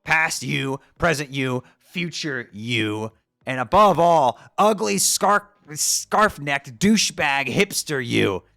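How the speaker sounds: rising from firm to a loud, slow sneer; escalating tirade ending in a loud drawn-out sneer; building angry rant. Acted.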